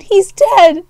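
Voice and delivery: high, loud wail; distraught cry. Acted. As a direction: wail it in shock. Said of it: "Sparktail whined".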